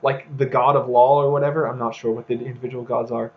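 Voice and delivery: normal talking voice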